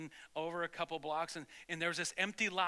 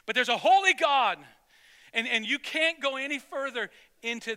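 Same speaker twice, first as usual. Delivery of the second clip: loud, raised pulpit cry; emphatic proclamation; 5 semitones higher, 8 dB louder than usual. Real, not acted. Preacher telling congregation of God's holiness, emphatic proclamation. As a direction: proclaim it with full force.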